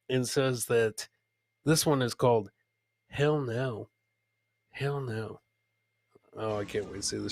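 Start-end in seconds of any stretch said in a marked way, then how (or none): none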